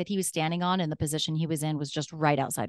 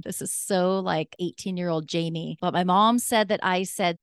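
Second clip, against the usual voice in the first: ordinary delivery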